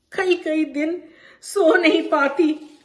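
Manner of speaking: pained rise on each question, as if reliving the hurt; anguished. Acted. Bano writing to Champa about families turned enemies, anguished.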